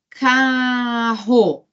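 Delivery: "carro" bem devagar, com o A esticado; pronúncia demonstrada. Shown as a demonstration. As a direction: separe "carro" em ca... rro, alongando. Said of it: "quando eu falo assim". ("carro" em duas sílabas lentas para ouvir os sons)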